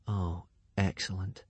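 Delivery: dry, slow and soft sarcasm; sarcastic. A narrator's reading. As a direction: say it wryly to yourself. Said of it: "thought Hiccup to himself".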